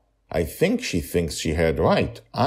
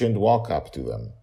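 plain reading voice

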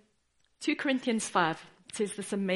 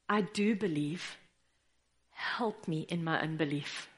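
quiet plea; 6 semitones lower, 7 dB quieter than usual. Retold or imitated.

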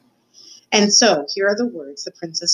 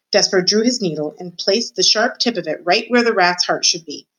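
plain reading voice

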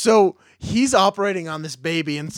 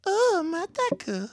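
much higher, slow silly wail; squeaky goofy wail; 13 semitones higher, 4 dB quieter than usual. Pretend. Finishing the stoner joke, high slow silly wail. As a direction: cry it out in a high cartoon voice.

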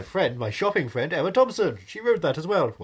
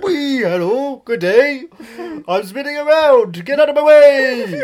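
high, loud, giddy fairy voice; Tinkerbell voice; 8 semitones higher, 8 dB louder than usual. Retold or imitated. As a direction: squeal it like a flying fairy. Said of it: "and I was like".

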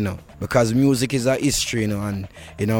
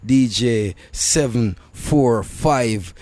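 DJ shout-out; much slower than usual. Acted. Radio shout-out, drawn out.

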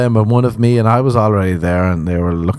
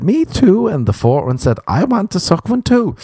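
much higher mock drag queen chirp; high camp drag queen; 10 semitones higher than usual.

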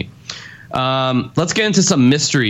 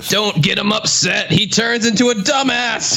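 mock warning; 8 semitones higher than usual. Mocks himself after the rant.

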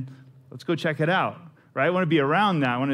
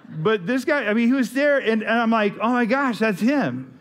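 excited; 7 semitones higher than usual. Retold or imitated. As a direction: gush like a starstruck fan. Starstruck seeing the singer.

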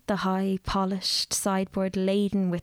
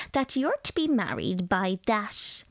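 lecturing lady; 2 semitones higher than usual. A narrator's reading. Mrs Dawson lectures the nervous girl about new tea, grandly. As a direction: pronounce on tea like an authority.

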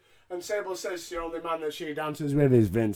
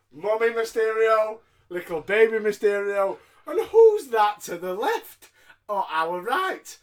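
mock announcer; 3 semitones higher, 9 dB louder than usual. Excited ringside commentary.

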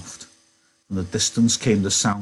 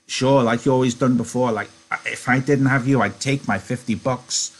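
higher, louder prompting voice; eager Lennie; 5 semitones higher, 4 dB louder than usual. A narrator's reading. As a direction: urge your friend on, insistently.

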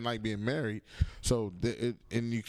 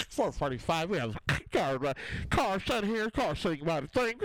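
high, put-on cop voice; Bill Cosby-like officer; 7 semitones higher than usual. Comedian mimics federal cop reporting his car, high caricature. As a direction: garble it like a cartoon officer calling in a car. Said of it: "Officer Bill Cosby".

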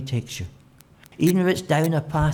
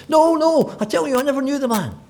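much higher, loud and fast; panicked denial; 8 dB louder than usual. Acted. Peter frantically denying Jesus to the serving girl, high and fast.

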